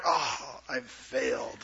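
soft, deflated admission of failure; defeated sigh. Retold or imitated. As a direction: let it sag out, quiet and beaten. Defeated sigh at day's end.

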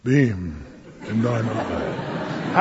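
very low, slow, hushed mock-holy voice; floating holy Jesus. Preacher mocking an otherworldly Jesus, low and slow. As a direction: drop deep and draw it out solemnly. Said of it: "the whole floating around".

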